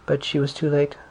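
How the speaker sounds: usual speaking voice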